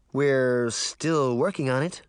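usual speaking voice

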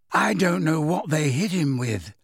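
put-on mildly downcast, airy girl's voice; understated regret; sad. A narrator's reading. Girl explains friend's odd state, a touch sad. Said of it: "said Luna sadly".

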